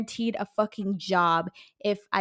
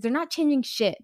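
ordinary delivery

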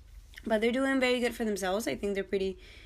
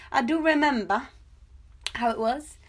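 mock nostalgic; 4 semitones higher, 4 dB louder than usual. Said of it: "says the woman who was born in ninety-six".

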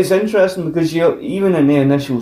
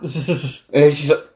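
ordinary delivery

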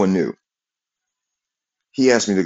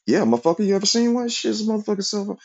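very high-pitched, incredulous comeback; mock indignant retort; faster than usual. Worker answering back about his cell phone, mock indignant. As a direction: fire back in an exaggerated high voice.

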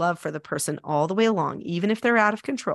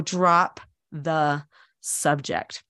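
very slow, lower, word by word; slow emphatic delivery; 6 semitones lower, much slower than usual. Real, not acted. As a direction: let each word of the advice land separately. Repeats key advice word by word.

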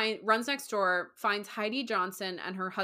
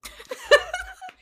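high-pitched laughing fit; high burst of laughter; 8 dB louder than usual. Real, not acted. To her co-host, a high burst of laughter. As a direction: let the laugh break out high.

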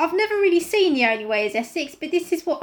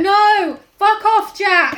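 loud, indignant telling-off; mock shout; 3 semitones higher, 6 dB louder, much slower than usual. Co-host heckling the villain outside the shelter, loud shout.

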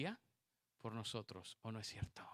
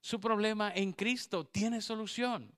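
Su problema en Cristo tiene solución, higher and louder; loud proclaimed repeat; 7 semitones higher, 6 dB louder than usual. Shown as a demonstration. Preacher repeating the promise to the women, louder. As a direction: hammer the promise home. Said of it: "repeated louder".

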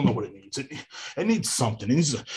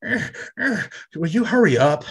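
high, strained moaning voice; mocking the neighbors' moans, high-pitched; mock neighbors; 5 semitones higher than usual. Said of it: "and they're".

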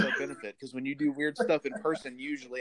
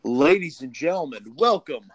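announcer voice; 3 semitones higher, 5 dB louder, slower than usual. Acted. Announcer opening the podcast.

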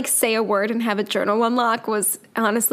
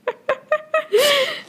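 high laugh. Real, not acted.

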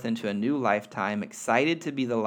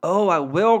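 higher, much louder eager promise; eager cry; 8 semitones higher, 10 dB louder than usual. Acted. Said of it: "cried the little soul".

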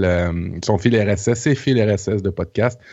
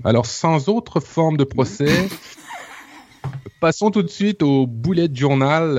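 drawn-out, higher-pitched segment announcement; slow mock-solemn host intro, stretched out; Guillaume impression; 5 semitones higher than usual. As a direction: imitate a co-host's slow, raised transition line. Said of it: "je vais le faire comme, euh, comme Guillaume".

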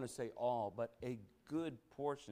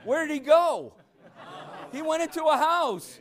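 preaching at full force; 16 dB louder than usual. Real, not acted. Preacher driving the verse home to the church, at full force. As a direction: proclaim it to the whole room.

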